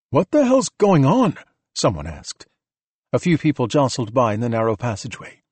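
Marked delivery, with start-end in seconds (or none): 0.1-1.4 high, drawn-out, bewildered demand; slow it down and pitch it up in alarm. A narrator's reading. Bystander alarmed by evacuation.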